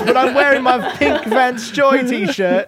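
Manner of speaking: loud, high mock British accent; hammed-up British accent, drawn out at the end; Seamus. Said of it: "making fun of my accent".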